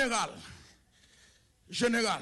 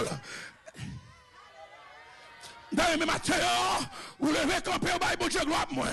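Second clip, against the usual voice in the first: raised, ringing sermon voice calling out to the church; full-force preaching; 5 semitones higher than usual. Acted. Preacher calling out to the congregation, raised and ringing. Preacher exhorting to give glory.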